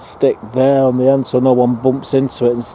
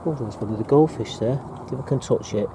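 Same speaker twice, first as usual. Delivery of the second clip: hushed; 9 dB quieter than usual. Real, not acted. Quietly approaching goldfish in pond.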